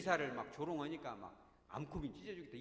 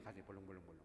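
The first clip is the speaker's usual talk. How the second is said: lower, softer pounding "벌렁벌렁벌렁"; mock racing heartbeat; 8 semitones lower, 8 dB quieter, much faster than usual. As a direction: mimic a fluttering heart. (두근거리는 가슴 흉내)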